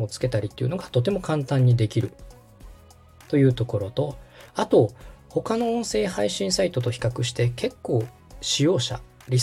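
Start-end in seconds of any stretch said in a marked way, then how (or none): none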